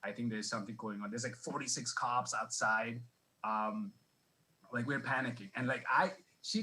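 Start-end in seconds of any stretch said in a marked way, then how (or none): 0.0-1.1 hushed manager. Retold or imitated. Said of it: "he's like".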